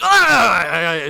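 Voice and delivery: loud drawn-out "ah"; hammed-up cry of disgust; annoyed cry. Alexander shaking Achilles off, loud mock cry.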